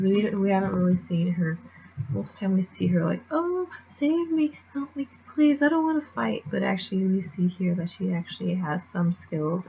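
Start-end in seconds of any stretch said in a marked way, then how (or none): 3.3-6.4 high-pitched damsel-in-distress whine; imitating a helpless character begging off a fight; mock helpless pleading.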